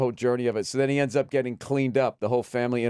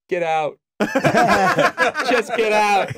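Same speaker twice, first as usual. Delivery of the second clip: raised, mildly dramatic order to leave; mock dismissal; 8 semitones higher, 7 dB louder, slower than usual. Injured swimmer throws his guilty brother out, mock dramatic. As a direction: act out the injured brother's outburst, half in jest.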